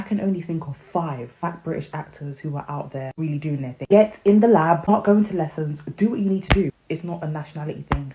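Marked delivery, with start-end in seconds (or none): 3.9-6.7 shouting it out, much louder; loud rant. Real, not acted. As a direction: bark the advice at them like a fed-up coach. Ranting advice to aspiring actors.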